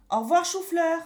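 put-on a child taunting the doctor; cheeky kid voice. A narrator's reading. Pierre cheekily saying goodbye.

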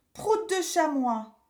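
put-on a child blurting nonsense rudeness; cheeky kid voice. A narrator's reading. Pierre insulting doctor.

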